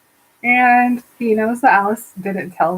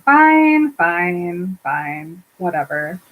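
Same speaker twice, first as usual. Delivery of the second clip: exasperated, fading into a dismissive whatever; grudging surrender; 3 semitones lower, much slower than usual. Retold or imitated. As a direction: give in huffily, deflating with each word. Sister grudgingly gives in.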